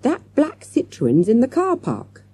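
high, urgent announcement; shouting. A narrator's reading. Man bursting in with news, shouting. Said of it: "he shouted".

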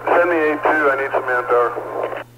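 crackly radio call, slow and urgent; urgent firefighter radio. Real, not acted. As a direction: firefighter over a handheld radio. Fireground radio request for manpower.

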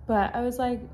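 ordinary delivery

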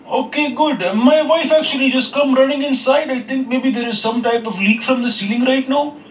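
high put-on foreign accent. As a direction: play a polite, worried tenant. Caller plays a new tenant.

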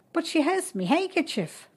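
put-on high, indignant boy's protest; boyish protest. A narrator's reading. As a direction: complain it isn't fair. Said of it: "protested Frank".

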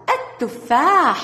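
slow التفاح said at-tuffah, lam silent, ta doubled; sun lam demo. Shown as a demonstration. Teacher slowly models the sun lam for children. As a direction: stretch التفاح slowly, skip the L. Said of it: "ندخل عليها ال التعريف ونقرأها".

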